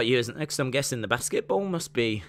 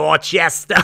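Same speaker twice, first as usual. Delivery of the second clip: Dorchester with dropped r, louder; Boston accent; 9 dB louder than usual. Retold or imitated. Imitating the Boston friend: Dorchester in a Boston accent. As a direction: drop the r in Dorchester. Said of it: "Hey, you got, like".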